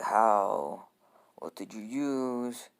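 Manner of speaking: slow, drawn-out demand; Gaara. Acted. Baffled kid looks up at Naruto, slow and demanding. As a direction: stretch out the baffled question. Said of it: "Gaara looks up".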